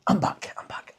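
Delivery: hushed whisper on return. Real, not acted. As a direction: whisper it, sneaking back in.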